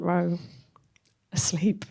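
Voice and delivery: hushed, breathy reveal; a whispered asleep; whispered punchline. Real, not acted. Punchline whispered about grandma asleep.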